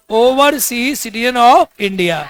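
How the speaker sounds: very slow, every word spaced out; slow dictation. Shown as a demonstration. Teacher dictating the full form to students, very slowly.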